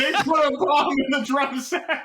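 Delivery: high, laughing through the words; amused disbelief. Real, not acted. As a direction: giggle at the absurdity. Laughing at the absurd plot.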